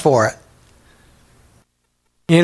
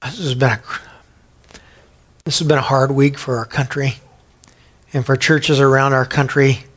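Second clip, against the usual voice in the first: prayer. Acted. Pastor prays over congregation.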